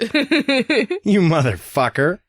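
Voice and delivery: high, very slow, outraged sass; drawn-out mock offense; sassy Hermione. Hermione offended at Harry, slow and sassy. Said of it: "Hermione's face like".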